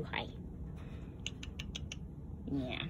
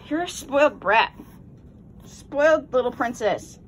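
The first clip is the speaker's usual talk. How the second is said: playful scolding; 5 semitones higher, 6 dB louder than usual. Real, not acted.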